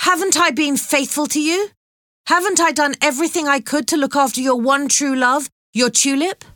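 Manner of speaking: high, impassioned protest; feelings bursting out. A narrator's reading. Rosa reproaching the man she loves, pitched high with feeling. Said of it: "no longer able to hide her feelings".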